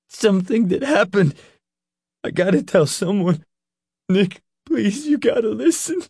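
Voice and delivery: very high, tearful pleading; voice rising and breaking. Acted. Leroy begging Nick to listen, voice breaking. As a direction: plead desperately as your voice cracks. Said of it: "his voice rising and breaking".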